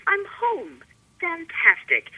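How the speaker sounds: delighted. Retold or imitated. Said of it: "I was like".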